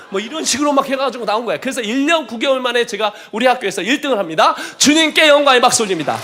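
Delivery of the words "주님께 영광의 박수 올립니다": louder, rising praise call; preacher's praise shout. Real, not acted. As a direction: call the crowd to clap for the Lord. Calling congregation to applaud.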